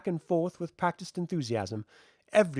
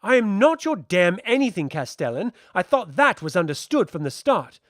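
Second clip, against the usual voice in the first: loud, sharp rebuke, higher and louder; angry retort; 4 semitones higher, 7 dB louder than usual. Acted. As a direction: throw it back at him hard. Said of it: "Why are you so angry".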